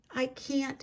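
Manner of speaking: John sadly refusing the butterflies. A narrator's reading. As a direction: let him down gently with regret. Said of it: "he told the butterflies sadly".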